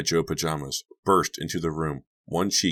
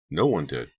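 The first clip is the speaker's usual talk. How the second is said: ordinary delivery, nothing marked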